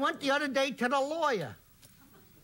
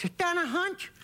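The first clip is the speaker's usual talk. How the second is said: joke character; 7 semitones higher than usual. Acted. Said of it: "He says".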